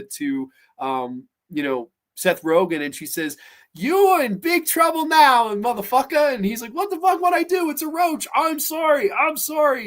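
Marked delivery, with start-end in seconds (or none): 3.7-6.3 hammed-up high-pitched cop warning; imitated policewoman. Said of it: "this woman police officer". 6.7-9.9 high-pitched pleading imitation; hammed-up flustered excuses; mock panicked apology. Acted panicked excuse to cop.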